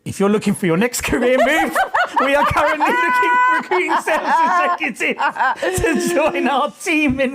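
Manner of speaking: high, loud, breaking into laughter mid-read; can't keep a straight face reading the twist; laughing while reading. Real, not acted. Reading the post's sales pitch to the co-host, laughing. Post turns into job ad.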